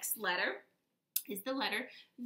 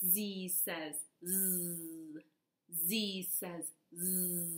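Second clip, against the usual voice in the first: slow, drawn-out "Z says zzz"; slow zzz demo; slow z drill; 3 semitones lower, 4 dB quieter, much slower than usual. Shown as a demonstration. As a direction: stretch every buzz slowly for the kids.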